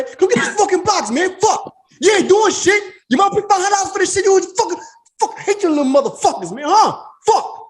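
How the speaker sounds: hammed-up yelling at the lazy kid; ranting old man.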